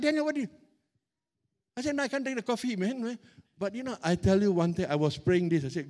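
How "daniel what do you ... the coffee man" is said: higher, exasperated mock protest. Pastor complaining to Daniel about his coffee, higher mock protest. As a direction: play up the outrage lightly.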